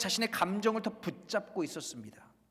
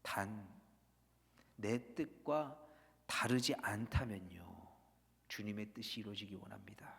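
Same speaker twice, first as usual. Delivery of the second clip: whispered inner thought; 8 semitones lower, 9 dB quieter than usual. Retold or imitated. Believer's hidden whisper behind the prayer, hushed. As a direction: breathe the secret condition under the prayer. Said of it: "이런 속삭임이 숨어 있어요".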